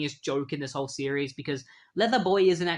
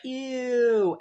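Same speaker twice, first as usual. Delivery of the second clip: disgusted ew; 9 semitones higher, 5 dB louder than usual. Retold or imitated. Crowd disgusted at the splat.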